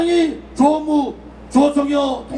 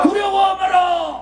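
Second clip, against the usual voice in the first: slow, shouted slogan line; roaring rallying speech; 3 semitones higher, slower than usual. Real, not acted. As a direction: lead the crowd chant at full voice. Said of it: "제가 선창할 테니까 따라해 주시기 바랍니다". (천천히 크게 외치는 선창 구호)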